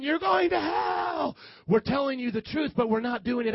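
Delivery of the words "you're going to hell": very high, slow mock shout; hammed-up holler stretched on "hell"; street-corner yelling. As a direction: parody a street preacher's drawn-out cry. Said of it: "yelling at people".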